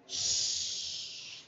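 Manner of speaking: long, hushing "shh"; shushing. Real, not acted. Teacher readying students for dictation, a drawn-out shush. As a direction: hush the class softly.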